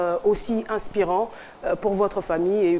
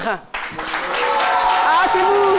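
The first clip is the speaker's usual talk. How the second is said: show host hype; 6 semitones higher than usual. Acted. TV host welcoming guests to the studio, hyped announcer voice. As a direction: greet the studio crowd like a game-show host.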